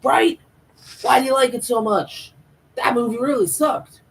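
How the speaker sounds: high, loud incredulous outburst; mock excited agreement. Both bonding over a movie they hated, exaggerated agreement.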